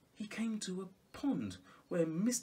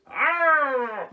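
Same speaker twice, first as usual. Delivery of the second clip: loud high gasp of alarm; startled cry; 10 semitones higher, 13 dB louder than usual. A narrator's reading. Storyteller cries out as Peter is spotted. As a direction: yelp in fright.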